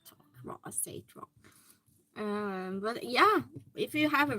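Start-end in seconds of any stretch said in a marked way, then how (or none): 0.1-1.3 whisper. Real, not acted. Aside about mispronouncing name.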